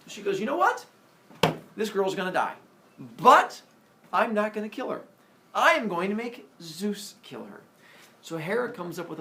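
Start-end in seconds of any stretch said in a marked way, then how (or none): 0.4-7.6 fed-up resolve. Acted. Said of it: "She's tired of Zeus's friendliness".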